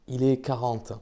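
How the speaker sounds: ordinary delivery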